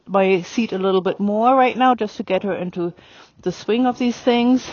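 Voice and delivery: usual speaking voice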